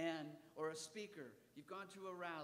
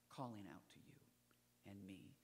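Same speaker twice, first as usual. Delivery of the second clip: hushed whisper; softly whispered calling; 11 semitones lower, 9 dB quieter than usual. Acted. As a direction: breathe it to the congregation. Sermon hush on God calling.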